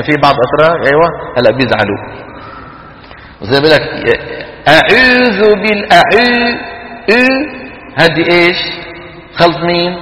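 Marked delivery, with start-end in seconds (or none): 4.7-7.3 higher, drawn-out French "ü" in أعوذ; waw mixed with ya. Retold or imitated. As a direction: mimic the Turkish fault, fronting the و of أعوذ to ü. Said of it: "خلط صوتها بشيء من صوت الياء". (أعوذ بواو مخلوطة بالياء مثل ü)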